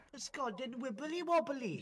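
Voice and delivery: high sing-song Welsh lilt, lightly done; mock Welsh accent. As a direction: do Nikki's Welsh accent, playful. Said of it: "my Welsh accent of Nikki doing her Welsh accent".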